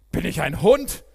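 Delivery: höhnischer lauter Ruf, deutlich höher; brüll ihn verächtlich an; gerufen. A narrator's reading. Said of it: "rief er David zu". (spöttisch laut gerufen)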